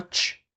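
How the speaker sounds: "tch" as a bare hissed affricate; t blurred into "tch"; wrong tch sound. Shown as a demonstration. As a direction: let out a vowelless "tch". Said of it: "c'est pas".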